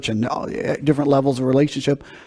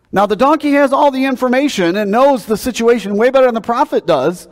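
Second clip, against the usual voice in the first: ordinary delivery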